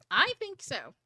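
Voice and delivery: a hint of a mild husband; light, offhand agreement as husband; husband's reply. Wife showing how husband answered, offhand. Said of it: "That's how he said it".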